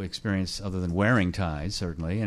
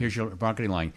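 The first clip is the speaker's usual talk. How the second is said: ordinary talk, nothing marked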